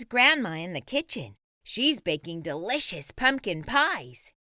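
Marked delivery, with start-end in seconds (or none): none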